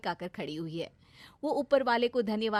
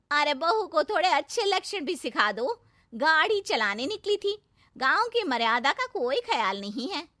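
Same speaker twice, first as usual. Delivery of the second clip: raised, busybody complaint; gossiping village woman; 6 semitones higher, 6 dB louder than usual. Acted. Village women stop the mother-in-law to complain, raised busybody tone. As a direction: nudge her to discipline the bride.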